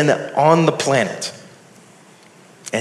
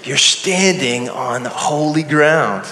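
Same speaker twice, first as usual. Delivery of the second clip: ordinary delivery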